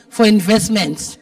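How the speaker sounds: ordinary talk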